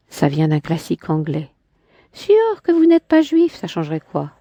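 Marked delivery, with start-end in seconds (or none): 2.1-3.5 higher, lightly voiced woman; a hint of a proud woman; Clémentine's voice. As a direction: lift into her haughty tone. Man voicing woman in dialogue.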